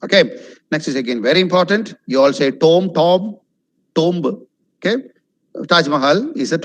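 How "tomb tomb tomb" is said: tomb as "tome", "tomb-buh"; wrong pronunciation. Shown as a demonstration. Teacher quoting what you all say, mispronounced. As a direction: say tomb with an O, even sounding B. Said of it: "It's wrong".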